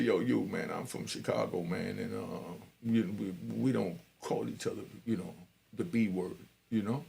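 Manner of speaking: low, quiet, serious friend; big friend from Chicago warning him quietly; quiet stern warning. Retold or imitated. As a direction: lay down the rule quietly.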